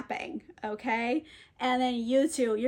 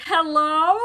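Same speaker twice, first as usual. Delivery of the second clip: louder questioning hello, rising; mock calling out; 3 semitones higher, 7 dB louder than usual. Trinculo wonders what's above the cape, mock calling out. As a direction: call out to whoever might hear.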